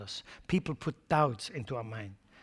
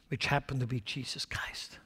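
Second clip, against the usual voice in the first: whisper; 5 dB quieter than usual. Acted. Sermon, dropping to a whisper.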